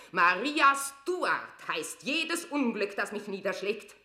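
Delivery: venomous cry against her rival; bitter shout. Acted. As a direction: hurl the hated name.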